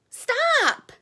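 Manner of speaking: sharp high "Stop"; emphatic stop. Real, not acted. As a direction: cut them off mid-thought. Urging viewers to stop skipping steps.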